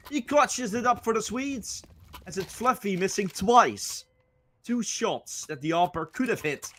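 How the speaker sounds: high, hyped live play-by-play; esports caster. Acted. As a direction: ride the hype down after the clutch.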